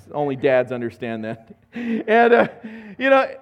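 ordinary talk

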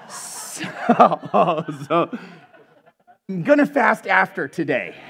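talking through a laugh; real laughing. Real, not acted. Preacher joking about fasting after barbecue ribs, laughing. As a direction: let the chuckle break into the words.

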